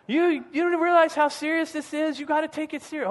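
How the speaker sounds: a lightly voiced stern surgeon, pitched high; mock scolding. Surgeon warning the student about his nose, mild mock scold. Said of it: "yelling at me".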